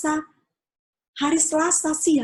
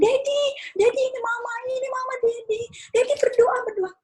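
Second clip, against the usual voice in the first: tearful calling; 12 semitones higher than usual. Retold or imitated. Wife calling to unconscious husband.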